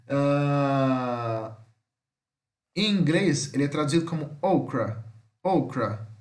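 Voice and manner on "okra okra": "okra" with a long English "oh" first; careful teacher's model of "okra"; English pronunciation demo. Shown as a demonstration. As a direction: stress the opening "oh" of "okra", falling after. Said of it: "O O em inglês é O".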